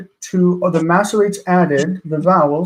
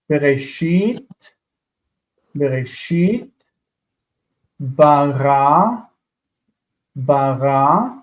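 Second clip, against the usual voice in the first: "Bereshit" and "Bara" each repeated, last syllable drawn out and rising; slow deliberate reading; much slower than usual. Shown as a demonstration. Teacher modelling words for students, slow careful reading. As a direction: read each word out carefully, pausing between. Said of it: "I just want to read slowly".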